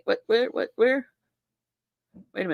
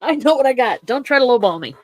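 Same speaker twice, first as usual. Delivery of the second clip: hammed-up haggler, louder and higher; mock tough seller; 5 semitones higher, 6 dB louder than usual. Hosts joking about their collection, mock stubborn seller. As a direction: play a stubborn seller refusing a lowball.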